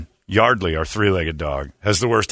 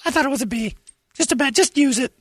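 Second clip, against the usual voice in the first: high mock gift-giver. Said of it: "go back and go".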